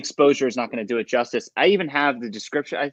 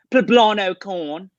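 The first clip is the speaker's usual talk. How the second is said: put-on higher, slow British accent like [name]; [name] impression; 7 semitones higher, 5 dB louder, much slower than usual. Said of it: "like, just [name], you know, that accent, that voice".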